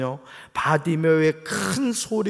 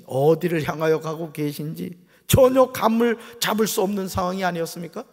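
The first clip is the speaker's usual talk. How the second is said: ordinary talk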